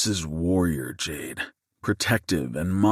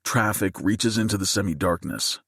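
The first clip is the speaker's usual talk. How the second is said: usual speaking voice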